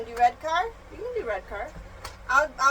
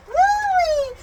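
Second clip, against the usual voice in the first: high, loud woo; quick burst of delight; cheering whoop; 11 semitones higher, 5 dB louder than usual. Real, not acted. Mother cheering the toy car, high whoop.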